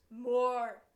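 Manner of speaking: loud barked demand; demanding shout. Acted. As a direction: bellow it like a greedy giant.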